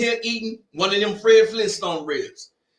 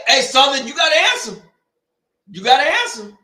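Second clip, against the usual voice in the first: taunting call; 5 semitones higher, 4 dB louder than usual. Real, not acted. Taunting the rival band loudly.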